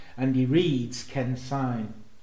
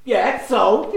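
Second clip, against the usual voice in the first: quoting Eddie through giggles; laughing as Eddie; 7 semitones higher, 6 dB louder than usual. Retold or imitated. Retelling Eddie's line, laughing too much. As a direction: squeeze it out while cracking up. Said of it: "completely through Eddie".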